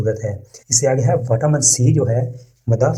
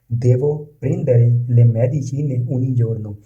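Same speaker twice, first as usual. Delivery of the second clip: very slow, each word laid out for learners; slow model reading; much slower than usual. Shown as a demonstration. Teacher reading the Italian example to learners, slow and careful. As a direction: read it slowly so students can repeat. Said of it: "teacher models Italian sentence".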